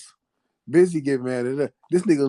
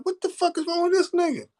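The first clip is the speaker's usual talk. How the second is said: much higher incredulous cry; what is wrong with him, pitched way up; high disbelief. Retold or imitated. Disbelief at drunk friend.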